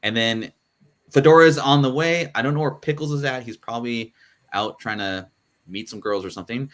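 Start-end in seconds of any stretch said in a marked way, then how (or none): none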